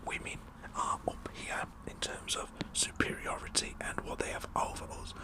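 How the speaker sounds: ordinary talk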